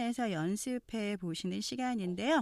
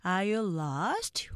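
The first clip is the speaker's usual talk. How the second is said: slow, drawn-out question with a final rise; stretched, careful English; slow-speed model; much slower than usual. Shown as a demonstration. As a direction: model it slowly for learners to repeat. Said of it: "먼저 느린 속도 발음 듣고".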